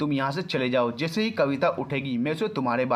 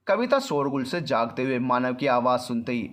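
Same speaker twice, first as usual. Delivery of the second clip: usual speaking voice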